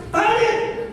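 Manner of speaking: high stop cry. Retold or imitated. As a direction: call it out like an alarm. Conscience voiced as red light.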